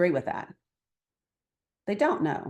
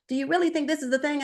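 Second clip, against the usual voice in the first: mock street talk, higher and quick; talking their way; 7 semitones higher, faster than usual. Speaker to two men about the border, in their casual street register. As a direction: flex into the listener's own way of talking. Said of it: "I'm talking to them the way they talk".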